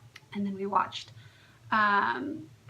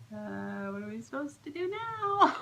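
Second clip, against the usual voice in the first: hesitant, higher-pitched mock bewilderment; puzzled; 4 semitones higher than usual. Patient unsure after the transfer, puzzled. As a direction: act out awkwardly not knowing what comes next.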